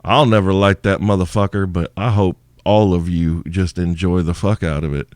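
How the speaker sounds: ordinary delivery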